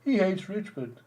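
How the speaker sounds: high put-on complaining voice; high-pitched mocking imitation of a sore supporter; mock Richmond fan. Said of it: "Richmond fan will say".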